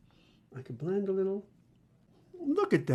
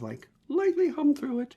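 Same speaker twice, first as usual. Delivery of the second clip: ordinary delivery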